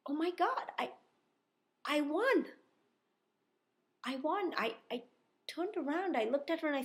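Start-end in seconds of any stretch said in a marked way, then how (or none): 0.1-4.5 high, loud cry of joy; thrilled disbelief. Retold or imitated. Player reliving winning the tiebreak, thrilled and loud. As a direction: relive the moment you realise you've won. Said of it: "I'm not doing this for dramatic effect".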